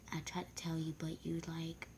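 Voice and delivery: hushed confession; secret whisper. Retold or imitated. Girl confessing she tried to tell, in a secret whisper.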